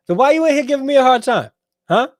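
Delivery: high-pitched, loud, quick mock complaint; mock indignation. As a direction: playfully whine it high and loud. Mock complaint to a chat commenter.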